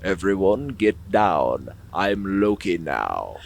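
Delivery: low, slow, hushed villain line; mock Loki announcement, low and soft; Hiddleston as Loki. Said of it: "Tom Hiddleston comes out on stage and says".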